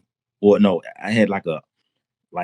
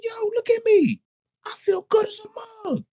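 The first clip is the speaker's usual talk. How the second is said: very high-pitched, giddy retold exclamation; elated. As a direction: re-enact being overwhelmed with joy. Elated, showing off to the girl.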